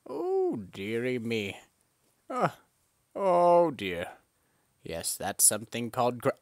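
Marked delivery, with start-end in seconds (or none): 0.1-4.1 mock old lady dismay. Mock fussy reaction to fall.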